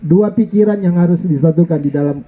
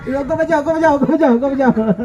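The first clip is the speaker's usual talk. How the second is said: high-pitched mock fussing, half laughing; mock angry wife; 6 semitones higher, faster than usual. Husband imitating his wife's outburst to congregation, mock angry. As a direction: play her outburst for laughs. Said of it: "Dia ngamuk".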